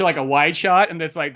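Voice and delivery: ordinary talk, nothing marked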